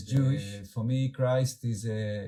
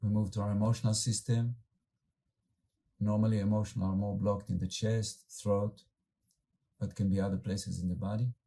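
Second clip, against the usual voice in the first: calm, unhurried meditation guide with long pauses; guided meditation. Acted. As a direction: gently lead the group inward, letting words settle.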